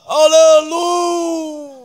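long, drawn-out loud high hallelujah; slow, loud, high shout of praise; joyful hallelujah. Acted. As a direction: stretch out a triumphant hallelujah. Celebrating the pastors' day off.

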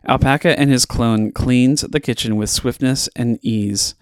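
ordinary delivery, nothing marked

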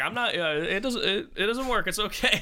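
high squeaky mock protest; imitating the cop. Cop insisting the sea mine won't work, high mock whine. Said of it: "he's like".

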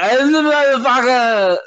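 high, slow mock holler of a rowdy subway guy; subway guy yelling. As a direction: stretch it out like a drunk on the platform. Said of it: "they be like".

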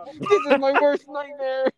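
slow, high, whimpering dread; whiny scared voice. Retold or imitated. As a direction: whimper it slowly in terror. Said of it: "and he's like".

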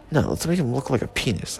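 ordinary delivery

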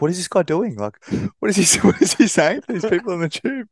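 high, bewildered quoted panic; a mate alarmed by Brad's antics on the tube; freaking out. Retold or imitated. Freaked-out high voice of a mate, imitating him. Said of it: "Mitch was, like, freaking out".

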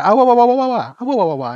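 wah-wah like a muted horn; muffled wah wah talk. Pretend. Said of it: "It's just a very fun thing to say".

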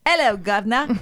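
hammed-up Cockney greeting; Cockney accent. Shown as a demonstration. Host showing off her Cockney accent to her friend, playful Cockney. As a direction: call it out in mock Cockney. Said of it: "Here's my Cockney accent".

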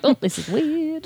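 high, slow, puzzled inner voice; lightly mock surprised. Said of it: "I was like".

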